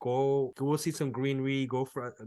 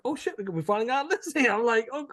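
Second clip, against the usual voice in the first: excited disbelief; 8 semitones higher, 4 dB louder than usual. Retold or imitated. Excited retelling of getting listing.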